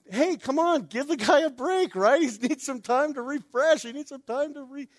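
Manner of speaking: high, wavering mock protest; a little ticked. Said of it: "they're a little ticked".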